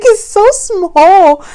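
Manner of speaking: high, loud, slow awed delight; husband marvelling at the tiny jumper; tender awe. Retold or imitated. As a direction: gush over the tiny jumper. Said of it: "he was like".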